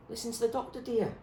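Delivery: calm her like a nurse. Acted.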